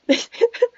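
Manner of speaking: high giggle. Real, not acted. Laughing after joke.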